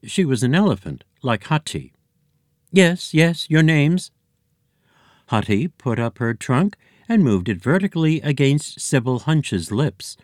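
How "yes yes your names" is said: high, loud busy receptionist voice; brisk receptionist. Acted. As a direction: clerk snapping through the queue. Receptionist elephant greets arrivals.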